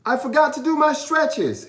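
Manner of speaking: put-on very high, loud little-boy voice; boy grabbing any reason to stall; excuse-making boy. A narrator's reading. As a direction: blurt out an excuse. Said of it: "he said to his dad".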